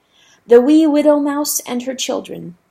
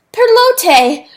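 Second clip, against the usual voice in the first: much higher loud call; cried; 13 semitones higher, 9 dB louder than usual. Acted. Rooster hailing the second boat, loud high cry. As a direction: cry the name across water. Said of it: "Chanticleer cried".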